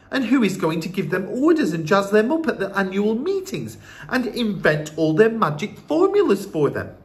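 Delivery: put-on high, urgent boy voice; boy pressing anxious questions; protesting young grandson.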